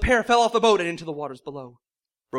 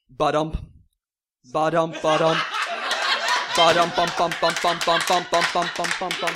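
mock heartbeat thumps, louder at the start; heartbeat noise; 2 semitones lower, 3 dB louder than usual. A narrator's reading. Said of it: "It went".

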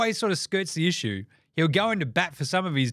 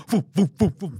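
punchy, louder flamethrower bursts; imitated whooshing fire; 4 semitones higher, 5 dB louder than usual. Host mimics analyst lighting fires, explosive mouth whooshes. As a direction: puff out short, forceful whooshes of flame.

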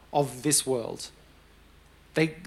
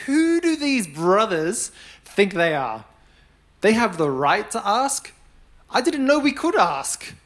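louder, higher outraged protest, drawn out; mock indignant; 5 semitones higher, 5 dB louder, slower than usual. Preacher voicing the disciples' envy, indignant. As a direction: act out put-out disciples in indignant disbelief. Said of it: "got indignant".

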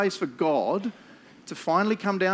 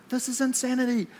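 mock disbelief; 8 semitones higher than usual. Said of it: "we were like".

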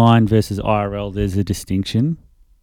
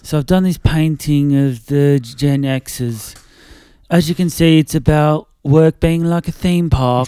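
higher, louder mock cockney gallery talk; semi-cockney impression; 5 semitones higher, 4 dB louder than usual. Cockney accent as Grayson Perry presents a generations tapestry. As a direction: play up a cockney art host presenting his painting. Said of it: "I'm just doing, like, a semi-cockney one".

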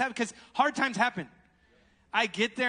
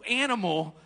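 ordinary delivery

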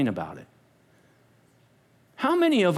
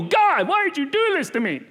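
mock complaint; 7 semitones higher than usual. Mock whining prisoner.

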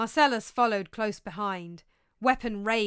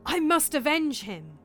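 louder, rising conviction; impassioned certainty; insistent; 4 semitones higher, 7 dB louder than usual. Acted. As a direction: build it in short emphatic bursts. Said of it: "insisted Hamlet".

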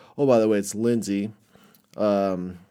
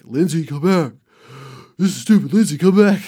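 much higher and louder, pleading call; calling after her; 7 semitones higher, 9 dB louder than usual. Retold or imitated. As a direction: call her back across the parking lot. Calling after storming girlfriend.

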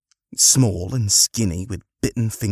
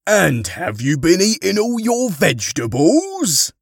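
loud, hearty, higher voice; Father Christmas; 10 semitones higher, 6 dB louder than usual. Acted. Harry as Santa questions the children, booming. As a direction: boom it out to a roomful of children.

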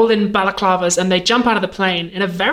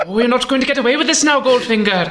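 quick mock-Welsh James Bond; hammed-up Welsh lilt; Welsh accent; 3 semitones higher than usual. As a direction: play Bond as a comic Welshman. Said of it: "Welsh James Bond".

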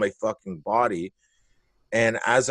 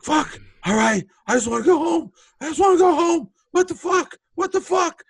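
high, louder, pained whine; whining victim; 4 dB louder than usual. Retold or imitated. The bitten guy whining after the fight, high and pained. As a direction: squeal it like a beaten man who wants to go home.

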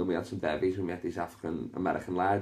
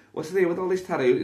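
excited question; 11 semitones higher, 5 dB louder than usual. Acted. First take, higher.

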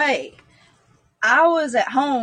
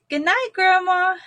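put-on childlike good night; 9 semitones higher than usual. Child greeting ghost grandma.

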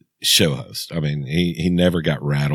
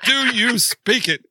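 movie quote; slower than usual. Retold or imitated. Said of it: "English, motherfucker".

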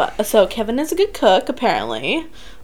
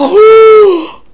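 drawn-out shout; 11 semitones higher, 11 dB louder than usual. Real, not acted. Fans cheering for their band.

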